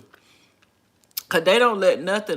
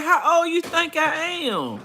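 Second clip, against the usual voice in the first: usual speaking voice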